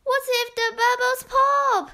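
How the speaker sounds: put-on high, fast, worried girl; Beth's voice. A narrator's reading. Beth fears the bubbles will pop, high and hurried. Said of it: "said Beth".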